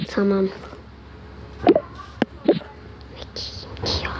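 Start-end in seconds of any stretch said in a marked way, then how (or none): 1.6-4.1 whisper. Acted. Muttering complaint to herself.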